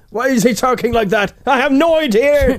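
high, loud, slowed silly voice; mock squeaky voice. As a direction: play a baffled squeaky onlooker. Mock voice in Hicks bit.

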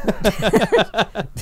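high, loud laugh; burst of laughter. Real, not acted. Co-host cracking up at the song, a loud laugh. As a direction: crack up helplessly.

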